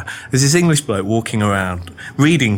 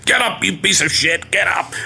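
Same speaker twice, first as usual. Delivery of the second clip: mock gruff order; 8 semitones higher than usual. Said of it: "your guy goes".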